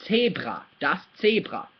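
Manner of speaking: "Zebra" as TSAY-brah, throat R; German pronunciation. Shown as a demonstration. Teacher summing up the word, German TSAY-brah for "Zebra". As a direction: say "das Zebra" the German way, ts at the start. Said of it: "practice with this r sound".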